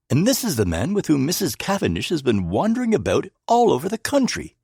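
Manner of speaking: loud, high indignant protest; indignant outburst about the doctor. A narrator's reading. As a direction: protest with mounting outrage. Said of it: "I cried indignantly".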